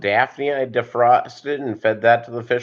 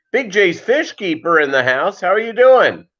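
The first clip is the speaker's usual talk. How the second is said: excited greeting; 7 semitones higher than usual. Real, not acted. Calling out a greeting to a viewer.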